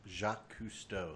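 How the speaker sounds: put-on French accent. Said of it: "That's whose voice we need".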